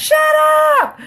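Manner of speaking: mock shout, drawn out on the last word; sudden yelled outburst, acted for laughs; retold yell. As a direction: imitate a fed-up yell at the kids. Retells a woman turning on kids.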